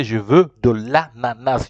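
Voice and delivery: slow, deliberate French correction; correct model phrase. Shown as a demonstration. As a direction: stretch out "de l'ananas" for the learner. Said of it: "You have to say".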